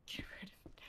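whisper. Real, not acted. Whispered laughing confession.

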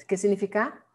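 ordinary talk, nothing marked

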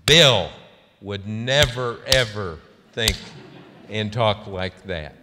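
very loud first word, then emphatic pauses; intense. Retold or imitated. Senator holding up Bill as the example, intense. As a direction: punch the name out in imitated indignation. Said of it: "She really got, uh, intense".

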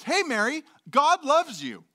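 high, loud friendly angel; cheery angel greeting. Retold or imitated. Angel greeting Mary, high and cheery. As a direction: greet her like a chipper messenger. Angel voiced in retold story.

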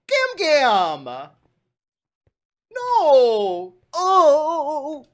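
high, loud hammed-up wail; mock-horrified shout. Grandchild horrified by grandma's bigoted proposal, mock-horrified shouting. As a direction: cry out in mock horror.

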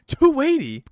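very high, loud squawk of disbelief; mock shock. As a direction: shriek the price back, incredulous. Shocked at the price.

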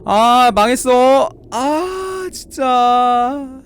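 high, drawn-out wail of defeat; despairing wail. Real, not acted. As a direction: wail it out long, pitch climbing. Losing the round in the game.

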